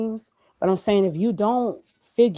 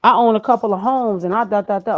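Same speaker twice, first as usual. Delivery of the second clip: casual artist; 5 semitones higher, 7 dB louder than usual. Retold or imitated. Artist answering her question.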